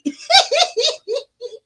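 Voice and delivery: a high whooping laugh; big cackle. Real, not acted. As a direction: crack up at your own setup. Laughs at own tease.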